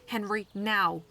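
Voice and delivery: loud sharp command; urgent order. A narrator's reading. Snow urging Henry to pick Saskia up, loud. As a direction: push him to act now. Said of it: "Snow said".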